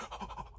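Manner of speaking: a quick breathless unvoiced gasp of excitement; soft gasp. Real, not acted. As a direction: suck in air as if thrilled. Excitement at the scene.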